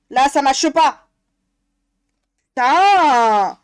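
fed-up loud curse; angry shout. Real, not acted. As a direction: yell at the misprint. Ranting at misprinted colouring book.

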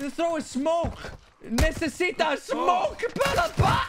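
high, slow panicked yell; panicked shout. Real, not acted. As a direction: shout for cover, losing it. Begging for smoke mid-fight.

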